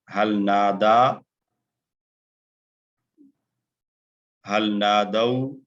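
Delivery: slow question with rising end on Nada and नादऊ; asking drill question. Shown as a demonstration. Teacher asks the question for students to echo. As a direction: pose each question slowly, lifting the end. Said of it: "मैं सवाल दोहरा रहा हूँ".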